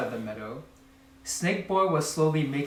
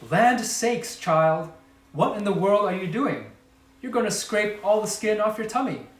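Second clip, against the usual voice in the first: higher, louder motherly alarm; 6 semitones higher, 3 dB louder than usual. A narrator's reading. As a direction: scold in a shocked fluster. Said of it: "and screamed".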